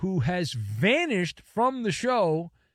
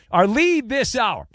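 louder, higher news lead; announcer voice; 6 semitones higher, 7 dB louder, slower than usual. Shown as a demonstration. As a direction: announce the top story. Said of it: "Don't bury the lead".

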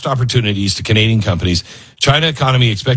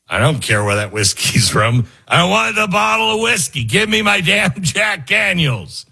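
mock whiskey drinker; 6 semitones higher than usual. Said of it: "We would just be like".

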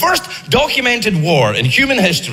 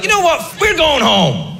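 giving up shout; 6 semitones higher than usual. Acted. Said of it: "the Greeks were like".

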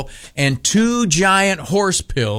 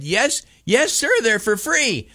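higher, humoring clerk voice; condescending clerk; 8 semitones higher than usual. Retold or imitated. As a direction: humor the old customer. Clerk humoring old man.

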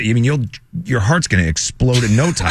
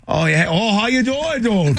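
higher, hammed-up Boston greeting; Boston accent; 7 semitones higher than usual. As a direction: put on a playful Boston accent. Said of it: "put on my Boston accent".

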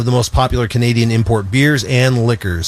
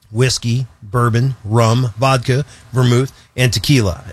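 ordinary talk, nothing marked

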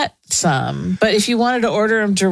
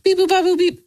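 high beeping noises; 11 semitones higher, faster than usual. Retold or imitated. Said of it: "rude dudes were like".

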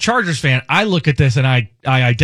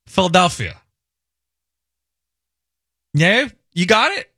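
ordinary talk

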